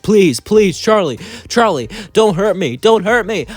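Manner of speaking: high, loud frantic begging; hammed-up terrified pleas, pitched high; scared pleading. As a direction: beg for mercy in rising panic. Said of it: "as he got scared".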